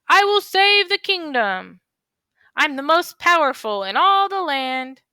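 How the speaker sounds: proud, bold character voice; boastful hero voice. Acted.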